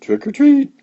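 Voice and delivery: high, sweet little voice. A narrator's reading. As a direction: pipe it up sweetly. Morris voiced by reader.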